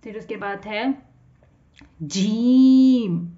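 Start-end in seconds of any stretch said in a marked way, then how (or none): 2.0-3.3 जीम stretched very slowly and louder; drawn-out jeem. Shown as a demonstration. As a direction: hold the long ee in जीम. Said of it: "इसको हम थोड़ा लंबा करके पढ़ेंगे".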